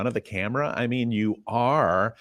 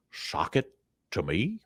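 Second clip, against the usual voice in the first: soft, halting catchphrase question; hushed, hesitant questioning quote; imitated catchphrase; 2 semitones lower, 6 dB quieter than usual. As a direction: quote it quietly, split by a pause. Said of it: "were you there when he said".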